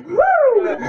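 high ecstatic whoop; joyful whoop. Real, not acted. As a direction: let out a glad whoop.

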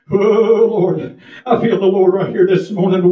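ordinary delivery

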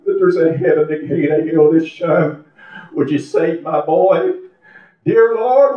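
retold pleading prayer, rising on the cries to the Lord; imitating a mother's earnest appeal. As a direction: plead like a mother praying for her son. Retells mother praying for son.

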